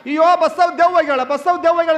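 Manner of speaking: high, loud mock-terror shout; panicked cry. Mourners see the corpse's arms rise, panicked ghost cry. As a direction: cry out in alarm, acting scared mourners.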